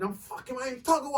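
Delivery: high-pitched, indignant protest; retold cussing. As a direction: act out an angry retort. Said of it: "when I was cussing him".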